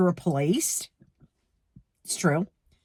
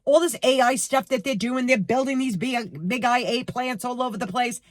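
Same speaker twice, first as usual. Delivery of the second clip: ordinary talk, nothing marked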